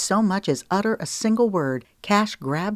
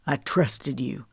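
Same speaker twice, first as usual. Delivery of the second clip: ordinary delivery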